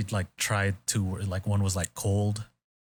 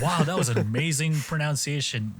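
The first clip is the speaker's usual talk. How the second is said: higher-pitched mock awe; amazed; 7 semitones higher than usual. Imitating hosts praising Nick's word, amazed. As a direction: gush as if deeply impressed.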